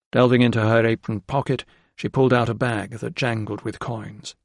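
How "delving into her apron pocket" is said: ordinary delivery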